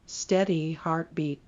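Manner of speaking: ordinary delivery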